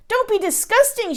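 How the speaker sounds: a high, sharp scold; scolding giantess. A narrator's reading. Giant wife scolds her husband, sharply. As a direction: snap it at your husband. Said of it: "she said".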